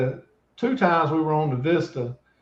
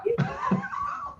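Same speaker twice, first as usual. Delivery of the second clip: high shrieking laugh; 8 dB quieter than usual. Real, not acted. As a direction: cackle hard at the punchline. Big laugh at story.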